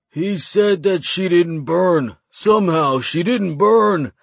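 high, slow creature voice; slow, high-pitched goblin telling it; goblin captive voice. A narrator's reading. Captive voice, awed report.

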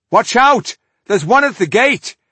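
high, loud urgent shout; shouted warning. Acted. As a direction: yell the warning across the yard. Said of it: "he warned Rodario and Frannick".

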